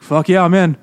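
loud, high, eager yes; eager mock agreement. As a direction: jump at the offer, hamming it up. Acted eager reply to treasure offer.

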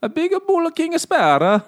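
high, loud cartoon Italian accent; bippity-boppity Italian accent. Shown as a demonstration. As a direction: bounce it out in hammed-up Italian. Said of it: "like, a bippity boppity Italian accent too".

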